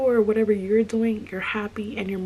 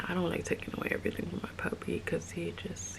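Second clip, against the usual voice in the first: whispering; 9 dB quieter than usual. Real, not acted. Soft whisper about puppy.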